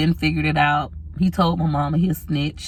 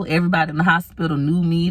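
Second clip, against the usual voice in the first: ordinary delivery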